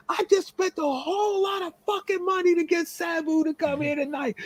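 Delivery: going nuts, high-pitched rant; Dennis Carluzzo. Retold or imitated. Promoter ranting that his star is snowed in, frantic and angry. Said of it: "He's just going off. He's going nuts".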